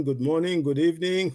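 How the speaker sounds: normal talking voice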